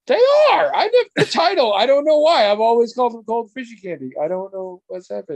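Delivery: high, loud, bewildered babble; retold flustered shock. Retold video woman caught out, flustered and shocked. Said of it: "She looks just like".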